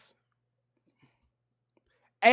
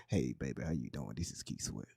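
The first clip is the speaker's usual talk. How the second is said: much lower, softer smooth radio host; late night radio DJ voice; 16 dB quieter than usual. Retold or imitated. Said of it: "late night radios when they be talking like this".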